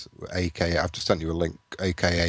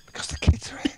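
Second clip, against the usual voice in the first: whispering. Real, not acted. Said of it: "Why are you whispering?".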